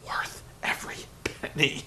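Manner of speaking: soft breathy leering whisper; steamy whisper. Real, not acted. Vlogger leering over a wrestler's looks in a whisper. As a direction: breathe it like a sleazy secret. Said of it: "Sorry, I got a little steamy there".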